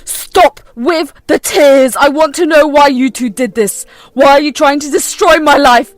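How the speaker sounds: furious son. Acted. Fuming son demands answers from his mother, loud.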